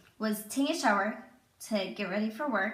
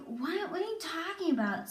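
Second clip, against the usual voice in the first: higher, softer baffled question; confused mom; 7 semitones higher, 3 dB quieter than usual. Retold or imitated. Sleepy mom answering her crying daughter, mildly baffled. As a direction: lightly imitate a mom just woken and puzzled.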